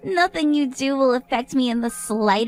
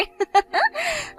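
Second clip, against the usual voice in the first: shrill giggling cackle, much higher; high drunken cackle; 14 semitones higher than usual. Acted. High tipsy cackle. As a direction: drunk guest cackling at the bride. Drunk guest mocks the bride.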